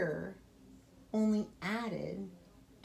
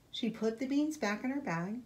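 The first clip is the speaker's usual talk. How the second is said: ordinary delivery, nothing marked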